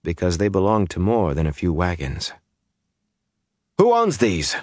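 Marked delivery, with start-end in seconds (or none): 3.8-4.5 abrupt, high-pitched question; blurted, pitched well up; sudden question. A narrator's reading. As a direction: burst out with the question. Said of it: "Aram asked suddenly".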